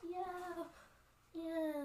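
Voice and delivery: high, soft, held-back cheering; mock cheer. Warriors receive the orc's head, soft high mock cheer.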